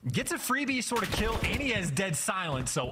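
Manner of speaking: high-pitched live esports call; urgent commentary riding the action; excited play-by-play. Real, not acted. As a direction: push the pitch up as the fight unfolds.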